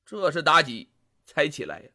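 usual speaking voice

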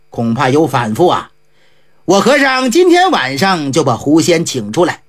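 put-on a low, knowing old monk; lightly voiced monk explaining the fox spirit to the family; Ji Gong's low voice.

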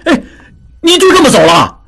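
音调很高的着急大喊; 冲着走开的人急喊; 急了眼. A narrator's reading. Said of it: "达庚急了眼". (达庚冲扬长而去的达庆急得高喊)

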